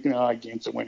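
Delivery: normal talking voice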